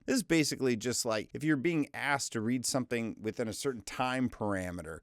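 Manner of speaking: ordinary talk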